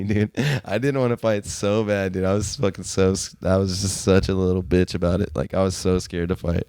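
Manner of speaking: normal talking voice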